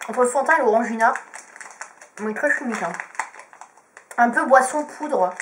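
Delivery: chewing between words; talking while chewing. Real, not acted. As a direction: mouth partly full of candy. Seen 3: Pursed lips, cheek bulge and jaw chewing visible between spoken phrases.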